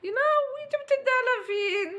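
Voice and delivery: high, startled cry of dismay; shocked exclamation. Real, not acted. Mother watching the spoon miss, high shocked exclamation. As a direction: gasp it out as the spoon misses his mouth.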